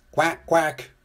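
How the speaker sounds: two short duck calls; duck quacks. A narrator's reading. Duck riding in Muck's scoop, quacking.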